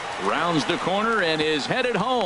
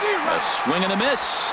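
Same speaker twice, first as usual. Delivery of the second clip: excited call; 8 semitones higher than usual. Real, not acted. Strikeout call ends the inning.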